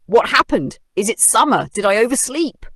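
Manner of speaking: high, loud confused questions; a hammed-up startled wake-up; panicked bear. A narrator's reading. Bear just woken by the roar, high confused panic. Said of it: "jumped up in a panic".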